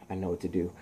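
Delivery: low, hushed hero to himself; quiet realisation. Acted. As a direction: murmur a plan under your breath. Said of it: "He's like".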